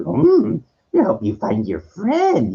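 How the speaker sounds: high, slow little alien master voice; high-pitched musing creature impression; Yoda. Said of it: "he was trained by Yoda".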